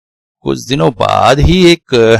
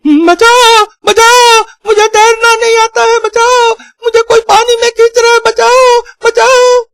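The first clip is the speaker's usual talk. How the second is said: very high, loud cries for help; panicked screaming; 4 dB louder than usual. Acted. Drowning thief cries for rescue from the pond, high panicked screaming. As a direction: scream like a man being pulled under. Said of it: "तालाब से चिल्लाने लगा".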